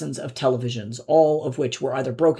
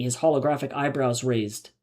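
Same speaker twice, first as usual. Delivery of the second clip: ordinary delivery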